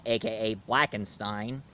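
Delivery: ordinary talk, nothing marked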